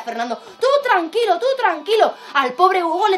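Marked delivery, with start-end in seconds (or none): 0.4-2.1 high-pitched little pig reassuring; reassuring piglet. Acted. Fernando calms his frightened brother, high and reassuring. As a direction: soothe a scared brother in a high cartoon voice.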